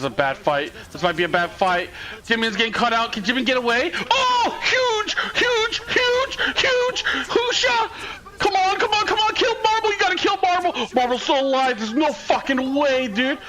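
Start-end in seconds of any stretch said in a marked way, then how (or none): 4.1-10.7 frantic cheering. Real, not acted. Streamer urging his team to kill Marble, frantic yelling. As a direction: yell at the screen to finish the kill.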